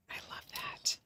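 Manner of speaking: breathy unvoiced whisper to herself. Real, not acted. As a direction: whisper it softly, just for yourself. Quiet aside after sweet message.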